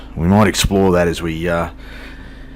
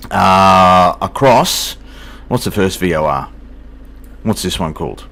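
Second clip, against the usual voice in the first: ordinary talk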